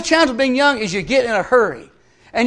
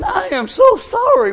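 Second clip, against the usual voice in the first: pleading apology; 10 semitones higher, slower than usual. Retold or imitated. Man apologising in retold story.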